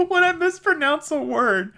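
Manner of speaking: high, loud laughing words. Real, not acted. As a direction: laugh while saying it. Laughing through the sentence.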